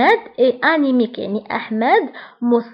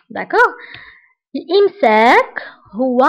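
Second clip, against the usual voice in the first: usual speaking voice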